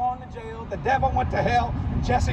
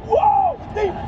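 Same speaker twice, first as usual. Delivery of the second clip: mock startled gasp; 4 dB louder, slower than usual. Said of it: "everybody went".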